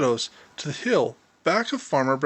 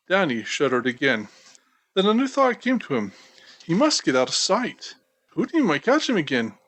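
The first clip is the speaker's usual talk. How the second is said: ordinary delivery, nothing marked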